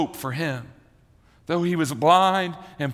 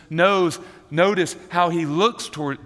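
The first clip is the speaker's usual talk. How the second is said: ordinary talk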